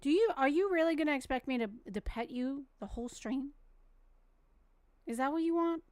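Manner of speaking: soft, fond telling-off of the dog; gentle mom voice to a needy dog; tender scolding. Real, not acted. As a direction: coax the pup to lie down. Gently scolding dog wanting pets.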